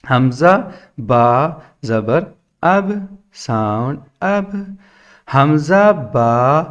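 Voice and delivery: slow, drawn-out chant with pauses between parts; classroom spelling recitation. Shown as a demonstration. Teacher demonstrating spelling then sound, slow sing-song recitation. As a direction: chant each letter slowly, then give its sound.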